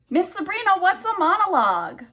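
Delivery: high-pitched pupil asking the teacher; puzzled student. Acted. Teacher voicing her students' thoughts, high mock-pupil question. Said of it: "what you're probably all thinking".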